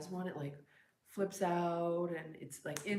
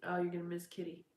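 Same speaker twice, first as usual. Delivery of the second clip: normal talking voice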